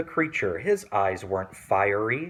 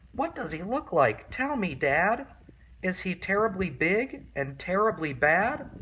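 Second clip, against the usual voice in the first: high, eager little-monster voice; a small child pestering Dad with questions; Gruffalo's child; 6 semitones higher than usual. Acted. Child asking her father about the mouse, high and eager.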